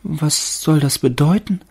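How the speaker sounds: hohe, langsame, staunende Baumstimme; Stimme des Baumes. A narrator's reading. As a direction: als ängstlicher Tannenbaum fragen. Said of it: "dachte der Baum". (hohe, zaghafte Baumstimme)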